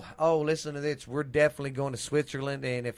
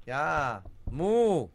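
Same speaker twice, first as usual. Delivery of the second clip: lightly put-on Canadian moo; a hint of agreeable Canadian cow; 2 semitones higher, 4 dB louder than usual. Doing regional cows, mild Canadian cow. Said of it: "a Canadians would be like".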